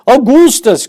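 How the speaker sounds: high, loud call of the name; a father crying out to his son. Acted. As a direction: bellow his name in alarm. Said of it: "cried Mister Gloop".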